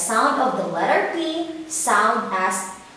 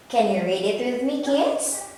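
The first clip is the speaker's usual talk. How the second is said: usual speaking voice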